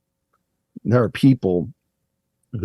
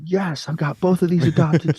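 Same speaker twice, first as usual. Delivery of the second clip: retold giddy cheer, pitched higher; excited; 10 semitones higher than usual. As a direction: replay your own teenage glee at the good news. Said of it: "So excited".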